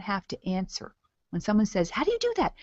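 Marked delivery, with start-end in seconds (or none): 1.9-2.5 very high-pitched mock wonder; hammed-up astonished asking; amazed curiosity. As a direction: squeal the question like an intrigued prospect. Acts out curious prospect's question.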